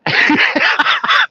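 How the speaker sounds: high rising laugh, breathy. Real, not acted. Remembering the crowd's faces, a high breathy laugh. Laughing at his own story.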